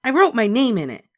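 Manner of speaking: put-on high, loud child's proud claim; announcing. A narrator's reading. Ramona showing her family the book, high and proud. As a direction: announce it like a proud little girl. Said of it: "she announced".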